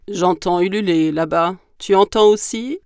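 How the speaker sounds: loud monster voice, rising question; big creature asking, lifting at the end; gruffalo voice. Acted. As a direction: boom it like a wary beast. Gruffalo asks the mouse.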